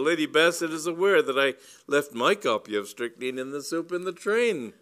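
loud, high, self-righteous claim; indignant assertion. A narrator's reading. Cyril claiming the book as his own, high and indignant. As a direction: insist righteously, voice climbing. Cyril backing up false accusation.

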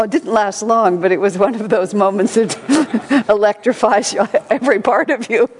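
ordinary delivery

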